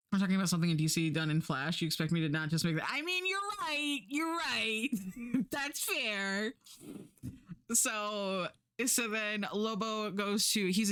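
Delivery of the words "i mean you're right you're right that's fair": high, amused admission; high-pitched concession. Real, not acted. Streamer conceding a point to chat, high-pitched concession. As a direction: give in, voice jumping up high.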